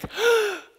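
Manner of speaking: sudden loud high gasp; shocked gasp. Retold or imitated. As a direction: gasp in horror at the spill. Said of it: "And I'm always like".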